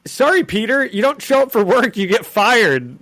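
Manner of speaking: hammed-up smug boss; mocking Jonah. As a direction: taunt Peter with fake sympathy.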